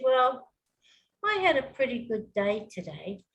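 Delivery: understated, unexcited account; boring delivery. Shown as a demonstration. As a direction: say it with no enthusiasm at all. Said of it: "That's a bit boring".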